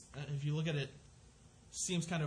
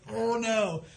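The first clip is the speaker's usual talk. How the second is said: loud, high mock dismay; mock horror; 7 semitones higher, 9 dB louder than usual. Parents caught out by foster kids in Walmart, loud mock horror. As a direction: blurt it out like a mortified parent.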